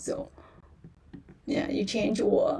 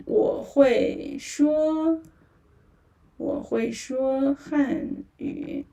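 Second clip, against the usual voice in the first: slow, careful 我会说汉语; teacher modelling 我会说汉语 slowly; slow model; 2 semitones higher, slower than usual. Shown as a demonstration. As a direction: stretch out each syllable of 我会说汉语. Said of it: "let's say it together".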